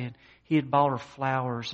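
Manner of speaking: normal talking voice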